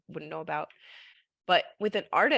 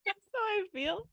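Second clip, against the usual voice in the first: high, soft little snail voice; tiny high snail, barely holding it; 14 semitones higher, 4 dB quieter than usual. Pretend. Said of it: "Can you do the voice of the snail?".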